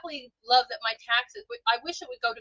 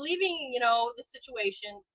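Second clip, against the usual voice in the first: usual speaking voice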